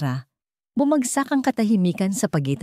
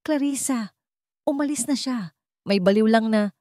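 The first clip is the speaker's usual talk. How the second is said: nervous false cheer; 5 semitones higher than usual. Acted. Emmanuel calling to Clarissa after Isabel leaves, higher and forced-light. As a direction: cover the panic with a light voice.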